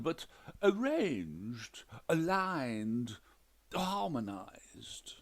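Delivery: slow, each word drawn out; pedantic emphasis. A narrator's reading. Widower lists the towel rules, slow and deliberate. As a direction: lay out each word slowly.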